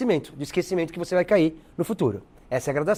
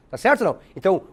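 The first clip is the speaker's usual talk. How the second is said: ordinary delivery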